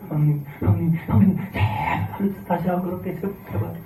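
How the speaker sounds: slow, drawn-out begging brothers; mock pleading. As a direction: beg for mercy, stretching each word. (형님들에게 애원하는 느린 목소리)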